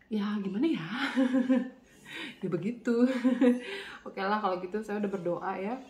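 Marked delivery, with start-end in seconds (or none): 0.1-4.1 giggling through the words; laughing. Real, not acted. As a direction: laugh it off awkwardly. Awkward answer about vaccine.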